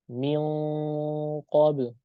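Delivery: Min qabl with the back of the tongue pressed up for the q; ikhfa before qaf. Shown as a demonstration. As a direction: press qabl hard from the throat, slow. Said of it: "Tidak boleh mil". (min qabl dengan pangkal lidah menempel)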